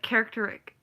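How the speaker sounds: "characteric" missing its -ist- syllable; imitated mispronunciation. Recalling the woman's wrong word, mispronounced. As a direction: say "characteric" short, ending -ter-ik. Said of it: "Or else, like".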